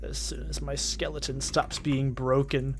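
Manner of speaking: lightly voiced alien villain; quiet cartoon villain threat. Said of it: "That's what he says".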